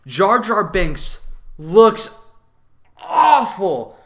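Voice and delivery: rising to a loud high cry on awful; slow build to a loud disgusted awful; disgusted outburst. Real, not acted. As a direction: exclaim it in disgust, stretching the words. Ranting about Jar Jar's looks.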